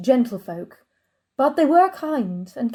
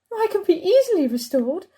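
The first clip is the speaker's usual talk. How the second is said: normal talking voice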